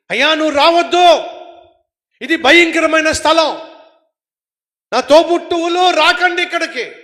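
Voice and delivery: high, loud cry to stay away; shouted warning. Acted. The dead calling to the living, shouted warning. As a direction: warn them off with a yell. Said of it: "ఏమని అరుస్తున్నారో".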